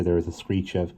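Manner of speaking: ordinary delivery, nothing marked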